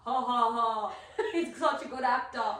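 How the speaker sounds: loud laughing quote; mock laughing. Friend laughing off the guide's stunt, loud and amused. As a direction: laugh it off as an act. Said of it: "and then Ian was like".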